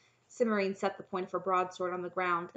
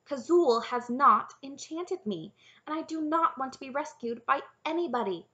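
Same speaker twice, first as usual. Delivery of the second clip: higher, louder emphatic protest; alarmed denial; 7 semitones higher, 7 dB louder than usual. A narrator's reading. Princess denying to the knight she's enchanted, alarmed. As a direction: insist on it, alarmed. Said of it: "alarmed by the knight's sudden enthusiasm".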